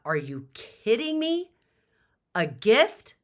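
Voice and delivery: high, very loud incredulous cry; outraged disbelief at the word gift; shocked disbelief. Retold or imitated. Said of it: "I was shocked".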